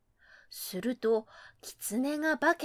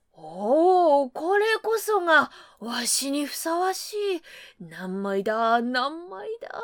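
put-on pompous old monk; 4 dB louder than usual. High priest voiced.

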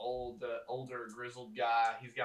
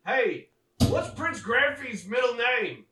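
loud, higher call across the camp; 11 semitones higher, 12 dB louder, slower than usual. Acted. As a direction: shout the question over to a coworker. Calling to another guy.

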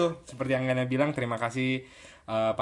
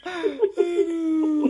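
laughing; 6 dB louder than usual. Real, not acted. Embarrassed laughing groan.

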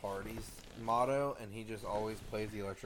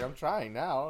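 higher, louder smug boast; 5 semitones higher, 7 dB louder, much slower than usual. Pretend. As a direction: announce "I'm trying now" like a smug heel. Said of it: "I'm just like".

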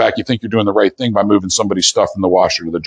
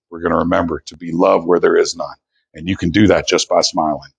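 ordinary talk

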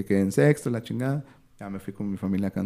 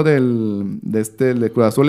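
ordinary talk, nothing marked

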